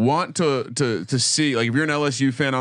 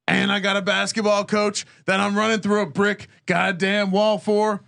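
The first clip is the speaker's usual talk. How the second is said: revved up; 8 semitones higher than usual. Real, not acted. Repeats the boast, revved up.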